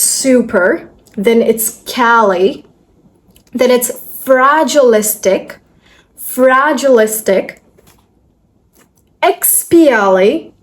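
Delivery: slow, chunk by chunk; broken into syllables. Shown as a demonstration. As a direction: say each piece slowly with pauses. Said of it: "we're just going to break it".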